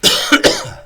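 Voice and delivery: dusty hacking cough; coughing on dust. Real, not acted. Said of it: "think I breathed in some dust".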